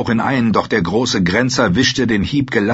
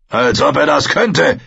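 raised-voice scornful taunt; calling out; 6 semitones higher, 4 dB louder than usual. A narrator's reading. Ranger scoffs at the young man's chances, calling out. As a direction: call it out higher, with scorn. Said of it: "rief Quoren".

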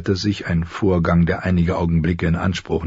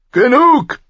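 very loud, much higher bark; barked command; 8 dB louder than usual. Acted. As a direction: bark the word like an enraged king. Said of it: "bellte der König". (gebellt, sehr laut)

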